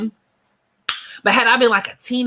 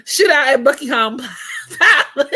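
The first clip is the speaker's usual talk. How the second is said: mock British drill; 5 semitones higher, 3 dB louder than usual. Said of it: "till they start rapping".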